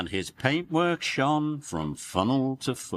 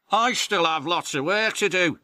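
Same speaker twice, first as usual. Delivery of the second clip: puffing Percy; 9 semitones higher, 5 dB louder than usual. Acted. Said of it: "he puffed".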